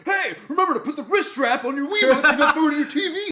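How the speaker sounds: very high, loud hammed-up shouting; Mister Resetti. Mole yells a console safety announcement, hammed-up ranting. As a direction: holler a safety warning like a furious scold. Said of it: "they should keep bringing him back".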